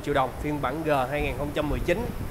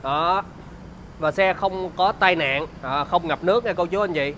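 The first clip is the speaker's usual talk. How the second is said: bright, upbeat sales pitch; slick used car salesman; 3 semitones higher, 3 dB louder than usual. Acted.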